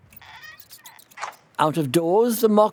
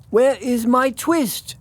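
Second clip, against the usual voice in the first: higher, slow, pleading question; anxious question; 7 semitones higher, slower than usual. Acted. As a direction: ask the cat once more, pleading. Tailor questions the cat.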